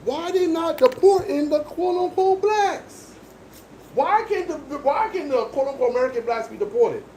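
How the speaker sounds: lightly voiced high-pitched complainer; mocking quote. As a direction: parrot their complaint in a high put-on voice. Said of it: "what they were saying".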